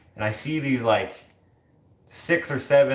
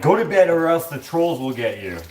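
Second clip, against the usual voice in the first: raised, higher mock parent warning; scolding parent voice; 7 semitones higher, 7 dB louder than usual. Someone scaring a child off to bed with trolls, mock scolding. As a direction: play a parent sternly sending a kid to bed.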